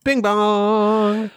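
high bing bong chime; high sing-song. Acted. Intro call and response.

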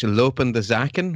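"lopende zaken" said slowly in native Flemish; Flemish term. Shown as a demonstration. Belgian guest naming the caretaker government in Flemish, slow and careful. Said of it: "what we call in Flemish".

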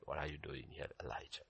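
low, very soft question; still small voice. Acted. God to Elijah at the cave, still small voice. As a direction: ask it gently under the breath. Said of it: "the still small voice".

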